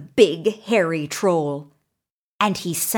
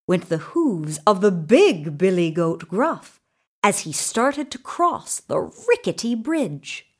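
ordinary delivery

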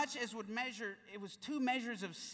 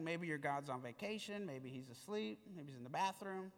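low, soft mocking taunt; a hint of taunting prophet, lower and softer; trash talking; 6 semitones lower, 8 dB quieter than usual. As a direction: needle them quietly with a smirk. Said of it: "He's trash talking".

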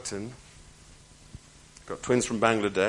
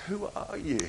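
higher, softer, choked-up voice; a touch of tearful; 8 semitones higher, 6 dB quieter than usual. Retold or imitated. Crying mother asking her helper who he is, tearful. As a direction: ask through held-back tears. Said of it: "the woman is crying".

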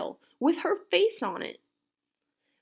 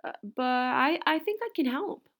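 higher, cheerful little greeting; brightly; 6 semitones higher, 4 dB louder than usual. A narrator's reading. Old woman at the front door, bright higher greeting. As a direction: pipe up politely and cheerfully. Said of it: "she said brightly".

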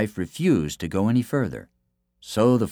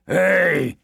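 high, raised roar of a challenge; giant's roar; 9 semitones higher, 4 dB louder than usual. A narrator's reading. Rival giant confronts the one holding his man, roaring. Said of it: "he roared".

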